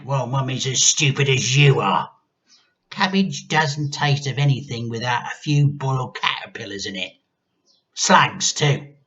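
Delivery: put-on an old woman barking orders; grandma ordering the boy about; bossy grandma. A narrator's reading.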